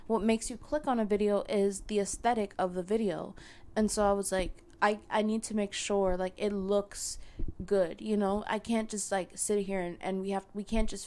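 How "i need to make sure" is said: ordinary talk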